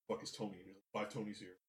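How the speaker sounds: low, hushed movie-quote voice; imitating quiet talking. Podcaster quoting Pacino's talking moments, low and understated. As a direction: mutter it low, like an offhand character line. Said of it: "moments where he's just talking".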